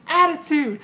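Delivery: loud, high punchy sass; hammed-up stage sass; sassy attitude. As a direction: throw it out with swagger. Imitates a sassy show moment.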